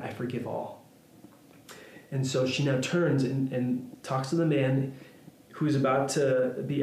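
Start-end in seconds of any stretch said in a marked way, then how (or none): 0.0-0.8 softer and lower, gently; soft forgiveness. Retold or imitated. Corrie to the guard, forgiving softly. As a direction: let it go quietly, tenderly.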